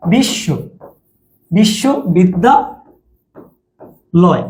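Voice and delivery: বিশ্ববিদ্যালয় very slow, বিশ্ব said as one chunk, then pauses; chunked pronunciation. Shown as a demonstration. Teacher showing students a three-syllable split, slow separated chunks. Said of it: "বিশ্ব একবারে উচ্চারণ করবে অনেকে".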